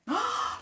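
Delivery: high, sharp acted gasp; delighted gasp. Sock spots a matching stranger, amazed gasp. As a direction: gasp in happy disbelief. Simon finds another stripy sock.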